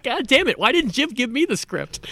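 half-laughing mock outrage over the script; laughing exasperation. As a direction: laugh your way through a mock gripe. Retold Murray, laughing through it.

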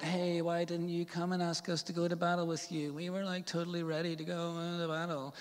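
quiet, put-on complaining voice; mock whingeing. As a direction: imitate indignant complainers, softly. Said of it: "giving all that".

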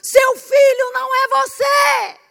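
loud, high, slow preaching; preaching emphasis. Real, not acted. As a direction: drive it home to the parents. Preaching to parents.

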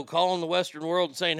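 normal talking voice

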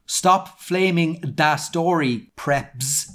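slow, emphatic misspelling read-out; all-caps author's note. Acted. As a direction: sound out every misspelled word heavily. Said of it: "In caps".

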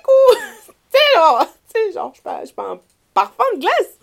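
real laughter breaking through high, loud protest; laughing indignation. Real, not acted. Woman mocking being treated as a novelty, laughing indignation. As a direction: protest incredulously through laughter, pitch shooting up.

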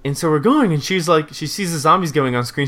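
ordinary talk

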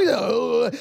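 ordinary talk

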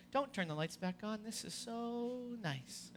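soft, dreamy and slow, barely above a breath; hushed contentment. Retold or imitated. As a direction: sink into the peace of the dark. Peaceful in the dark.

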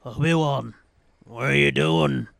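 a hesitant, unprepared stab at Liam Neeson's Qui-Gon. Retold or imitated. The Qui-Gon ghost calling out to Obi-Wan, in a half-hearted Liam Neeson voice. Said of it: "I don't have a fucking Liam Neeson prepared".

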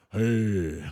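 the deep God voice everyone expects; low, resonant mock God; booming God voice. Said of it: "'Cause everybody's got this".

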